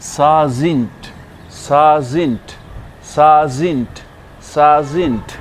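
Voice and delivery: साजिंट very slow, long aa; drilled word. Shown as a demonstration. Teacher models साजिंट slowly for learners. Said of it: "next word है".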